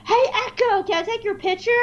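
high bright eager greeting; friendly fan asking for a photo; high excited fan voice. Retold or imitated. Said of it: "for me just go".

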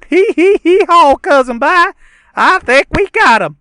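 very high, loud giggling yokel; silly cousin voice. Acted. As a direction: squeal it like a dim cousin. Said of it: "we can do a dramatic reading".

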